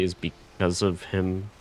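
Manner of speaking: ordinary talk, nothing marked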